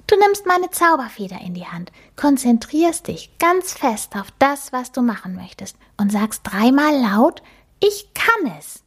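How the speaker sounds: a cute magic mouse introducing herself; little mouse voice. Acted. The magic mouse introduces herself to Emma, high and squeaky.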